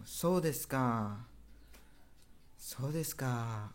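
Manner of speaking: "So desu ka?" slow, each one falling; falling intonation. Shown as a demonstration. Teacher drills the acknowledgement for students, slow falling "So desu ka?". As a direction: draw out "So desu ka?" and let "ka" sink. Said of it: "intonasinya itu menurun".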